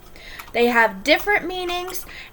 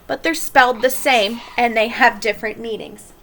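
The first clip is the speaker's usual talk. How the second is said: ordinary talk, nothing marked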